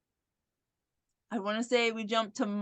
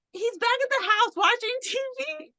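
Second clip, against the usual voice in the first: much higher, offhand voice; breezy dismissive; 11 semitones higher than usual. Retold or imitated. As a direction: toss it off like it's nothing. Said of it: "And he's like".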